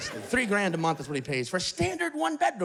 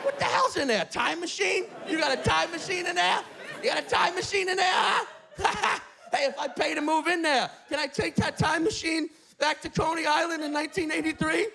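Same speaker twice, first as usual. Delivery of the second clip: put-on shouting old man; 6 semitones higher than usual. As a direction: rant at the trees, pitch way up. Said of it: "He's just shouting it".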